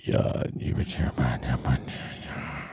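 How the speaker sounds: low, breathless whispered robot voice; Stephen Hawking voice. Retold or imitated. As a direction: rasp it out slow and toneless. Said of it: "Stephen Hawking's voice, where he says".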